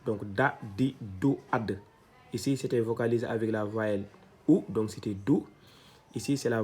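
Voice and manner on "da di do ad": "da, di, do" said one by one; teacher drill; syllable drill. Shown as a demonstration. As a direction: run through the vowels on d. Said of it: "on avait dit que c'était do".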